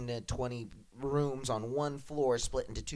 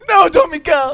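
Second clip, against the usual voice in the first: high wailing cry, much louder; 17 dB louder than usual. Retold or imitated. Pupil wails to the dance teacher, high wailing cry. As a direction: wail the answer like a heartbroken pupil. Student answers teacher, cries out.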